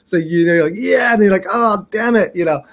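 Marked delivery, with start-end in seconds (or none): 0.8-1.1 high-pitched retold celebration; short, elated exclamation; happy cheer. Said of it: "it was happy and sad at the same time". 1.5-2.3 high, groaning retold curse; mock-dismayed grumble, pitched up; comic disappointment. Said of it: "it was happy and sad at the same time".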